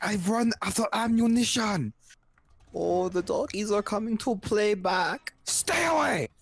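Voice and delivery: high hammed-up German scientist yelling at the dogs; Nazi scientist voice. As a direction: ham up a panicked German scientist. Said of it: "Nazi scientist's voice".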